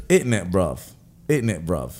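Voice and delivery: put-on English rapper accent. Man saying he can do that, English rapper accent. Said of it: "sound like those English rappers".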